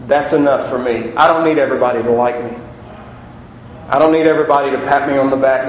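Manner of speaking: ordinary talk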